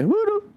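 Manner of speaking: playful, very high-pitched "woo-doo"; high whoop. Real, not acted. Host signing off till next Wednesday, high playful whoop. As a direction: let out a high sign-off whoop.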